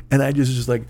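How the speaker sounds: ordinary delivery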